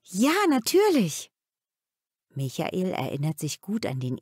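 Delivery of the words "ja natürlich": excited. A narrator's reading. Michael excited about the ring.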